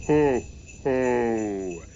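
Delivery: loud, hollow, dismal ho ho ho; a gloomy evil Santa laugh; dark Father Christmas laughing mournfully from the sleigh; mournful hollow laughter. A narrator's reading. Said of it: "came the mournful, hollow laughter".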